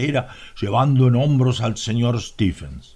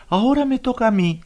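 eager; 12 semitones higher, faster than usual. A narrator's reading. Miss Drummond eager for her turn.

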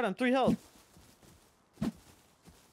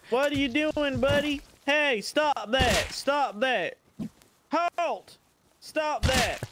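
high and louder, ordering him to stop; high scolding shout; 8 semitones higher, 4 dB louder, slower than usual. Pretend. To the raider hitting him, a high louder scolding shout. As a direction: yell stop like a shrill hall monitor. Scolding the enemy who attacks.

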